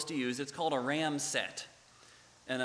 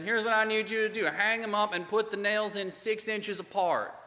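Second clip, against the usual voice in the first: put-on Southern accent; 7 semitones higher, 4 dB louder than usual. Site boss Doug instructing the student volunteer, mock Southern accent. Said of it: "this thick Southern accent".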